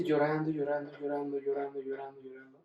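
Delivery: droning repeat, fading away to very soft; imitating endless weeping by repetition, no sobs. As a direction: repeat it over and over, trailing into near silence. Retelling endless crying at the funeral.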